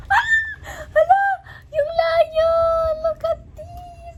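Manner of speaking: very high, loud delighted shriek; excited scream. Real, not acted. Vlogger unboxing the lion, excited scream.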